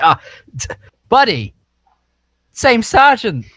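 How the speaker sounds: bright friendly shout, rising high; excited call out. Acted. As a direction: hail him like an old pal. Player calls out in character.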